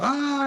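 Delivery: mock high-pitched startled shriek; startled yelp. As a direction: squeal like Marty being jumped at. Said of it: "he's like".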